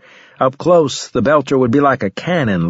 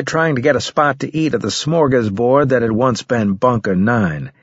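ordinary delivery

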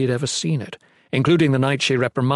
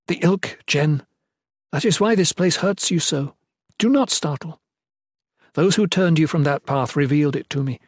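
higher, earnest woman's character voice; queen, confessing; 4 semitones higher than usual. Acted. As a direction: confess carefully, then press on.

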